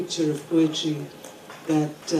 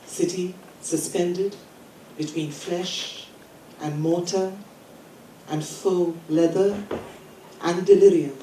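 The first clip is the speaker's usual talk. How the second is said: incantatory list, each phrase set apart; poem reading; 3 dB louder than usual. Acted. Poet reading the title poem about the city to a conference, emphatic recital. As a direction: recite with emphatic openings and deliberate pauses.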